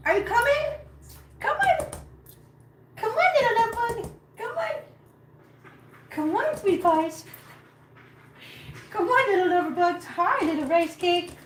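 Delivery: high, sweet pet-talk voice; calling cats. Real, not acted. Owner greeting little lover bugs, high affectionate calling. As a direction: coax them along in a high voice.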